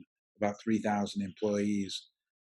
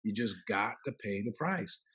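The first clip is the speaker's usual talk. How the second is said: a lightly put-on Southern coach accent, softer; 7 dB quieter than usual. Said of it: "he had a real Southern accent".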